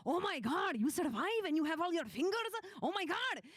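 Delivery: high, loud, thrilled Punjabi uncle; excited Punjabi uncle accent. Acted. Uncle greeting party back from dragon hunt, excited Punjabi accent. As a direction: burst out amazed in a Punjabi accent. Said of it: "he was, like, so excited".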